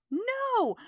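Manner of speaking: high protest. Real, not acted. Protesting co-host's trauma joke.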